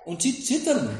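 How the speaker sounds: preacher's dramatic echo, pitched high. Acted. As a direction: lift "zittern" high and let it fall. Repeated line, raised second take.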